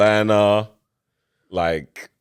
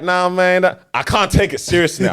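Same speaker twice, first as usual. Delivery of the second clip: mock Houston accent; 6 semitones higher than usual. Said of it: "when they hit you with the, like".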